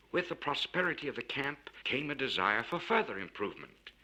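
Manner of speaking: plain reading voice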